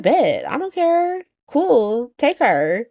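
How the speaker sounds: high, careless mock shrug; dismissive Ava. Ava shrugs off the hostage swap.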